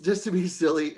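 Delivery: normal talking voice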